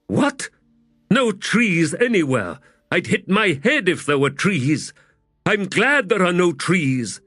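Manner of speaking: high, booming giant woman; giant warrior woman. A narrator's reading. Teblor woman's voice.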